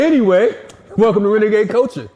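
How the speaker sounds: loud welcome. Real, not acted. Host loudly welcoming guest.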